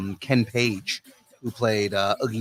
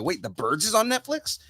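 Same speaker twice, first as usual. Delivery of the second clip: high, rising double-take; retold surprise, pitch climbing to the end; surprised; 9 semitones higher than usual. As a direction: question it in pleased disbelief, voice going up. Retold reaction to a discovery.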